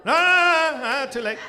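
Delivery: real mock-offended shout at the crowd; laughing shout. Real, not acted. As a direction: wave off the late applause with a shout. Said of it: "I was hoping for some clap".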